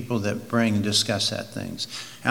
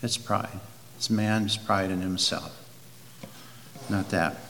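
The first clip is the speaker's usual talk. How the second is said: quietly, a touch humble, softer; 6 semitones lower, 10 dB quieter than usual. Retold or imitated. Preacher quoting a humble pastor, soft and humble. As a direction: say it softly and humbly, no arrogance. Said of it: "He said it very humbly".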